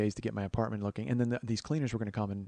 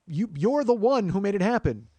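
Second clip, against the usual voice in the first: high, loud, slow blame; hammed-up exasperated finger-pointing; mock accusation; 8 semitones higher, 8 dB louder than usual. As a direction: pin the blame on them, stretching it out. Imagined accusation at the traveller.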